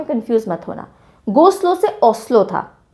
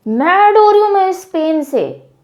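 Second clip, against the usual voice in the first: mock anger; 5 semitones higher than usual. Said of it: "I'm a good actor by the way".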